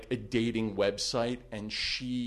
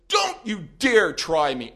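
slow, loud menace; menacing threat; 8 semitones higher, 11 dB louder than usual. Retold or imitated. Attorney threatening the stalker, slow and loud. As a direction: lean into each word slowly. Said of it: "He goes".

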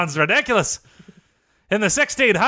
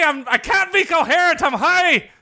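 yelled back; 8 semitones higher than usual. Retold or imitated. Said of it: "Tiger turned around and yelled at me".

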